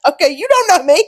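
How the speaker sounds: high, loud comeback; mock offended retort. Kid answering dad's jab on the phone, mock offended. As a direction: snap back in playful indignation.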